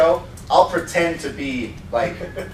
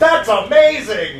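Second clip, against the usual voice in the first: excited shout; 5 semitones higher, 5 dB louder, slower than usual. Retold or imitated. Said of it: "Peter just yelled".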